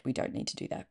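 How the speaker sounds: unvoiced, whispered aside. Real, not acted. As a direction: drop to a whisper.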